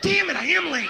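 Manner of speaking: high, exasperated cry; exasperated outburst. Acted. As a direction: burst out in mock frustration. Acted exasperation at the clock.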